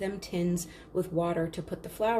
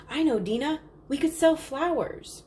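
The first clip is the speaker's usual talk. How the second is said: put-on higher, louder child voice rising with excitement; eager little sister; 8 semitones higher, 4 dB louder than usual. Little Chandra pipes up to Dina, higher eager child voice. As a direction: sister blurting out her bright idea.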